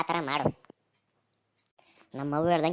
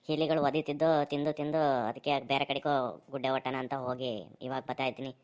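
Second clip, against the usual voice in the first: ordinary talk, nothing marked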